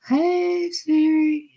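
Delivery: high sing-song call to the phone; calling for Siri. Retold or imitated.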